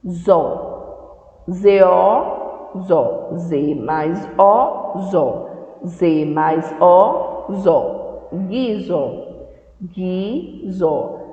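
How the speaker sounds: slow syllable drill; slow spelling. Shown as a demonstration. Teacher spells syllables for children, slowly. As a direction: sound out "Z mais O, zó" for kids. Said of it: "teacher spells syllables slowly".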